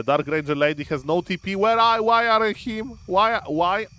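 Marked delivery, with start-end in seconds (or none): 1.6-3.9 higher, louder, anguished why; dismayed cry. Real, not acted. Streamer watching his hero get caught, dismayed cries. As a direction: yelp in disbelief at the screen.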